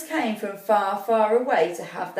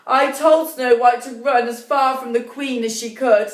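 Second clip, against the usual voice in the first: louder, higher, insistent huntsman; the huntsman blames the queen, pushing hard; upset huntsman; 3 semitones higher, 5 dB louder than usual. Acted. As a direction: huntsman pleading his case, raised and urgent. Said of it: "the huntsman stated".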